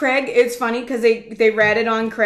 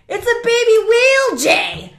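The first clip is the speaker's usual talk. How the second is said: high, loud imitation of a Gloucester guy; Gloucester accent; 13 semitones higher, 5 dB louder than usual. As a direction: holler it in a thick Gloucester accent. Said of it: "that's exactly what Gloucester sounds like".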